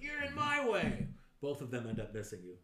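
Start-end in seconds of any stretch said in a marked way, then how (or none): 0.0-1.1 very high, loud retort; angry shout. Acted. GM voicing the second guard snapping back, angry shout. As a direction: yell back at him. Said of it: "He's like".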